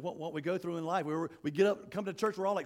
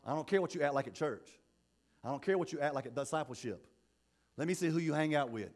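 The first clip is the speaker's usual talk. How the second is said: ordinary talk, nothing marked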